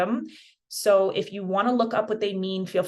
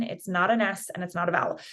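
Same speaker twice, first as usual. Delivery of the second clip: ordinary talk, nothing marked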